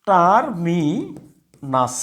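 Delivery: টার্মিনাস stretched slowly syllable by syllable; slow model pronunciation. Shown as a demonstration. Teacher modelling the word, very slow. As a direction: draw out টার্মিনাস for the learners. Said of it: "কি হবে?".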